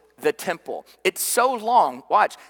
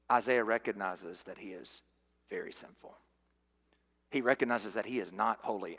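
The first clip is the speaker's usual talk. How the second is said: low, very soft preaching; solemn, fading almost to a whisper; grave hush; 7 semitones lower, 10 dB quieter than usual. Real, not acted. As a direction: drop down and leave long pauses. Grave hush on Isaiah's sinfulness.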